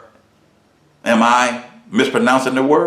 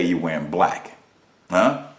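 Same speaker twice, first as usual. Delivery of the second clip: normal talking voice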